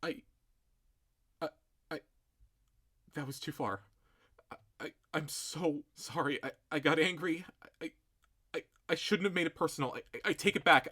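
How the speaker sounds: soft, stuttering remorse; shaken stammering apology. Acted. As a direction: trip over every word. Stammering apology after insult.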